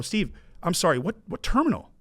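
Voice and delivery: high, eager mock-naive ask; clueless eager question. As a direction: sound like a kid who's never flown private. Naive question on phone to mentor.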